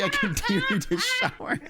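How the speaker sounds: high, laughing deadpan joke; laughing through it. Real, not acted. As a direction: laugh while admitting the punchline. Brother jokes about ignoring.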